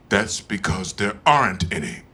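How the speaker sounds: low, slow, booming voice; voice from above. Retold or imitated. Voice speaking to Pryor in Africa, low and slow. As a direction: speak like an unseen voice of judgment. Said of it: "And the voice said".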